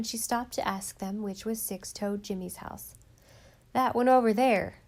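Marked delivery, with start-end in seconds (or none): 3.7-4.8 put-on loud, rough boy's call. A narrator's reading. Fisher boy pointing Nan to the house, loud call. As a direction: shout it across the sand.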